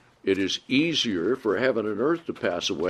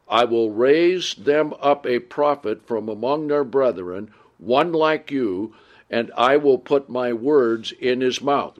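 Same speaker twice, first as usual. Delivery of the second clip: louder, measured Bible reading voice; scripture reading; 3 semitones higher, 4 dB louder than usual. Acted. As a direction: read the verses out to the congregation with weight. Reads scripture aloud.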